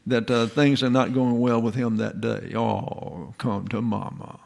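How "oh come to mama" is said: softer, drawn-out sympathy; comforting mother. Acted. Mother takes in her upset child, softly consoling.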